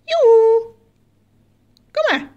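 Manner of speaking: high calling voice; singsong beckoning; coaxing yoo-hoo. Real, not acted. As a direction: call a pet over with a high hoot. Calling the bird to come.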